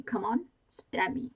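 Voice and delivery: slow, loud, goading dare; loud slow taunt. A narrator's reading. Repeated taunt, slower and louder.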